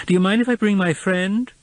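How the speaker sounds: high hopeful neighbour; eager woman's voice. A narrator's reading. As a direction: pitch it up, eager. Charlotte voiced by narrator.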